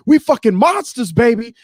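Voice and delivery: high, loud hammed-up hype man; hyped performer. Spoken-word performer hyping the crowd, high and loud. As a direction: strut it out like a spoken-word showman. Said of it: "He's like".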